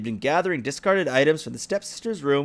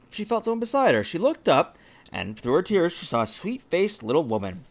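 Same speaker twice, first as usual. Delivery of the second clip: plain reading voice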